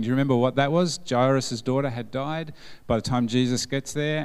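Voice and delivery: ordinary delivery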